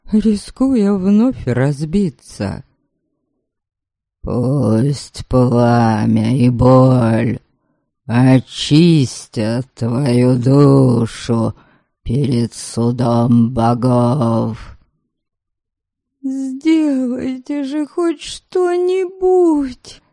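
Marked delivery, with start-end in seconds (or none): 4.2-14.7 low, slow rumbling spirit; rumbling ghost. A narrator's reading. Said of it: "Пророкотал призрак". 16.3-20.0 high, slow desperate plea; plaintive plea. A narrator's reading. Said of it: "Жалобно взмолилась я".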